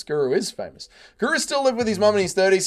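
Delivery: ordinary delivery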